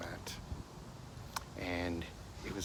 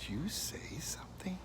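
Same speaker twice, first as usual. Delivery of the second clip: stunned whisper; 9 semitones higher, 7 dB quieter than usual. Retold or imitated. Stunned whisper at talking dog.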